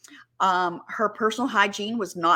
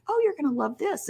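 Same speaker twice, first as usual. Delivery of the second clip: retold coaxing; 6 semitones higher than usual. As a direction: coax lightly in a higher voice. Sisters eagerly coaxing her, retold.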